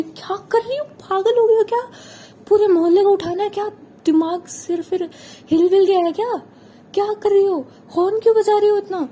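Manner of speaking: high, loud panicked scolding; alarmed shouting. Acted. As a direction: yell at her to stop honking. Kashish yells over honking horn.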